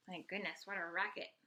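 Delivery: ordinary delivery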